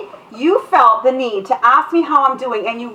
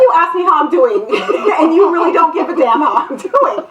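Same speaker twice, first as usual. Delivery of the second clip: mock indignation; 6 semitones higher, 4 dB louder than usual. Mock indignant at fake greeters.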